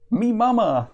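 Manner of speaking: cavewoman's high, proud announcement; proud exclamation. Acted. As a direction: proudly exclaim it, pitched well up. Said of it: "the woman exclaims proudly".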